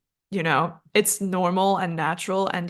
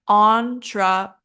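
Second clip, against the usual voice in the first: syllable by syllable; 4 dB louder than usual. Shown as a demonstration. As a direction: say "entrepreneur" in four separate beats. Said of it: "our stress is the first syllable".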